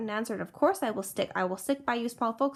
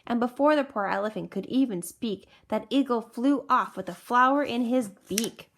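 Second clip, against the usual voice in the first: ordinary delivery, nothing marked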